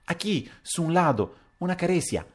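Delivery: put-on high-pitched, hesitant foreign girl; high little voice with a Spanish tinge; Pepita's voice. A narrator's reading. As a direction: answer in a high girlish voice. Narrator voices young Spanish-speaking Pepita.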